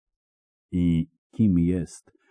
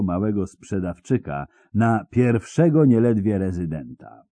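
menacing interrogator; 4 semitones higher, 3 dB louder than usual. Acted. Interrogator taunting the bound spy, menacing. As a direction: savour every word like a torturer.

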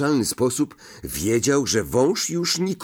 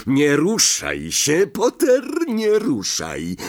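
higher, drawn-out menacing order; slow drawl; 6 semitones higher, 3 dB louder than usual. A narrator's reading. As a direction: draw the warning out with relish. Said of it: "wycedził Snape".

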